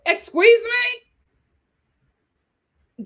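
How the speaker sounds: a slow, high, scandalized excuse me; outraged. Real, not acted. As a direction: drag it out in disbelief. Outraged at T Money.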